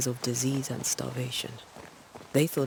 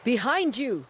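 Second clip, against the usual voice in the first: high urgent call of alarm; warning call; 10 semitones higher than usual. Acted. Warning her partner of a ghost behind her, high and urgent. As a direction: snap her attention to the threat.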